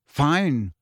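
ordinary delivery

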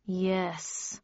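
subdued, reluctant reply; grim. A narrator's reading. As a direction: agree grudgingly. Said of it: "replied Tweed grimly".

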